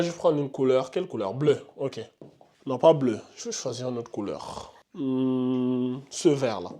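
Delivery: très bas, grave, presque marmonné; réfléchis tout bas, comme pour toi seul; à mi-voix pour soi. Real, not acted. Se parle en choisissant une couleur. (parle tout bas pour lui-même)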